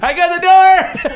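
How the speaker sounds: high, slow eager shout; in-character shout. Pretend. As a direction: call it out over the chaos.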